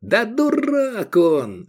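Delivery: slow, high-pitched shout; panicked cry. A narrator's reading. Envoy excuses his friend to the sheriff, crying out. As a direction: cry it out with a long stretched word. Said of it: "закричал Мирарзал".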